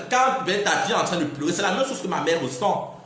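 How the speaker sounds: ordinary delivery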